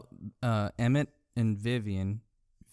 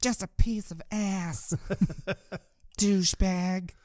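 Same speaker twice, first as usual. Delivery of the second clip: higher, slow mocking whine breaking into a laugh; mock hurt whine; 8 semitones higher, slower than usual. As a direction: whine it like a caricature, laughing midway. Mocking Elle's hurt complaint.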